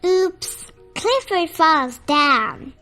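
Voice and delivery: usual speaking voice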